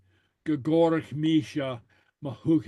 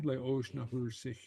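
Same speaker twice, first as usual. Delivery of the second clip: Le Oshna Hursek much softer, sighed out sadly; 4 semitones lower, 11 dB quieter than usual. Shown as a demonstration. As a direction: let Le Oshna Hursek fall away like a sigh. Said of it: "a sad sigh".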